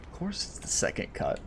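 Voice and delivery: hushed sarcastic whisper. Real, not acted. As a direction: mutter it under your breath. Echoing commentator, hushed.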